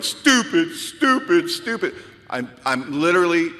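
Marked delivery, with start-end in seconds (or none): 0.0-1.9 self-berating. Retold or imitated. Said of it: "banging my head on the steering wheel going".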